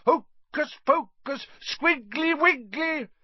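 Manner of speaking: slow, loud, high mock incantation; fierce voice. A narrator's reading. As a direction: cast a fake spell fiercely. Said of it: "said Harry in a fierce voice".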